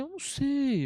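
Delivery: high-pitched baffled reply; perplexo. Retold or imitated. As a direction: act out being thrown by the question. Resposta perplexa à pergunta sobre morte. (resposta perplexa, em tom agudo)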